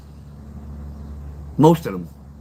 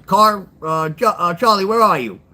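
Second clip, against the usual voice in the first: higher, louder radio call; on the mic; 5 semitones higher, 4 dB louder than usual. Retold or imitated. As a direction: call out over the dispatch mic. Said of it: "He goes on the mic".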